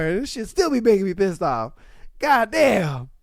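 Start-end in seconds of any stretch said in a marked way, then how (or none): none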